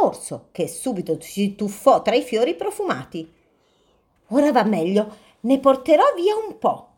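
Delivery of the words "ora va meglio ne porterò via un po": lightly voiced hungry bear, higher; a greedy bear, announcing his plan.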